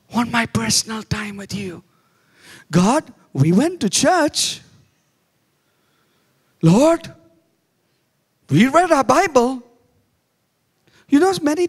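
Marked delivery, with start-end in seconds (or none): 0.1-1.8 tender echo. Acted. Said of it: "God looks at me and says". 2.7-9.6 loud, raised call of Lord; hammed-up churchgoer proclaiming duty; mock pious call. Preacher mocks a dutiful churchgoer, loud and raised.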